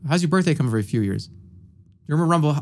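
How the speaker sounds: ordinary delivery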